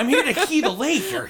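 high, laughing nerd voice. Retold or imitated. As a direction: play the giddy laser-tag geek. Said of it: "They're like".

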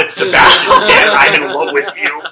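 slow, shouting, laughing gush; mock fangirl scream. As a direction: scream it like a starstruck fan. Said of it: "and then it's".

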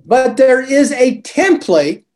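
ordinary delivery

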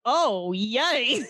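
"Oh, yay." said brightly with a put-on cheer; mock cheerful. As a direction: lift "Oh, yay." as if truly delighted. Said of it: "But still is not like".

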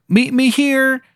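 high, slow Baltimore hon voice; Maryland accent. Acted. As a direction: stretch out "one hour" in a high Maryland twang. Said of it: "And he goes".

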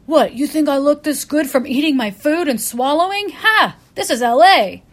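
loud, high, smug Hollywood guy with a scoffing "Ha!". Retold or imitated. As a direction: ham up a vain LA writer. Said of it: "And he was like".

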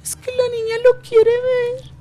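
high whiny wife voice, near tears; man doing a woman's voice. Retold or imitated. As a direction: wail the name like a tearful wife. (hombre imitando voz de mujer llorosa)

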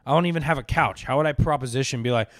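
ordinary delivery

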